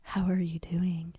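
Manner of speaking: slow breathy whisper, mock seductive; sexy whisper. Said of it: "that's me sexy talking".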